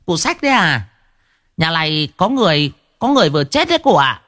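high, chattering gossip woman voice; high-pitched gossiping old woman; nosy village gossip. A narrator's reading. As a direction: spill the news like an eager village busybody. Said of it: "Một mụ hóng hớt liền bảo".

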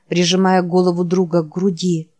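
ordinary delivery, nothing marked

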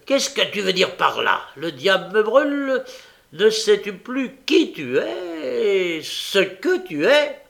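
loud, high, threatening demand; furious bully laying down the law; angry outburst. A narrator's reading. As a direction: roar the challenge, stretching the final words. Bully rages at the girl.

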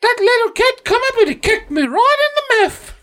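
very high falsetto British granny; hammed-up shrill old lady. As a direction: wail like a mugged English granny on the news.

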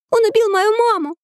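put-on very high child's voice; little girl. A narrator's reading. Girl repeating her accusation, high little-girl voice. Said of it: "повторила Мариам".

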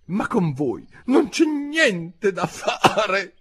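high, slow, drawn-out complaint; high-pitched bird's lament; exasperated pigeon. A narrator's reading. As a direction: wail it in despair, stretching the end.

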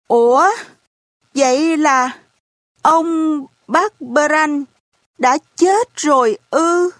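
hỏi lại nghẹn ngào, cao giọng; thốt lên nghẹn ngào vì xúc động. A narrator's reading. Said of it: "giọng tôi đã nghẹn đi vì xúc động". (cậu bé hỏi bà cụ, giọng nghẹn lại)

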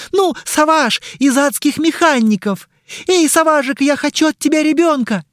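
high, loud excited squeal; squealing fangirl. Acted. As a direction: gush at him like a starstruck fan. Fan squeals about star.

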